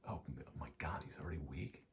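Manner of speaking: ordinary talk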